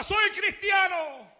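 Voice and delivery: high, slow, drawn-out declaration; crying out. A narrator's reading. Tortured believer cries out his faith, high and slow. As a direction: proclaim it under torture. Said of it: "él gritaba".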